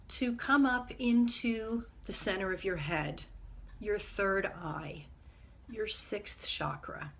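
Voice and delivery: calm, measured meditation guide with long pauses; guided meditation. Acted. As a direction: ease listeners gently inward, leaving space between phrases.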